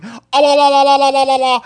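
loud, high rapid "la-la-la" whooping; mock war whoop. Host imitating someone taunting an Indian, mock war whoop. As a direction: crude imitation war whoop at full volume. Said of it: "walking up to an Indian going".

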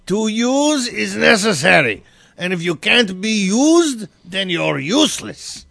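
slow, heavy German accent. Shown as a demonstration. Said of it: "all in a German voice".

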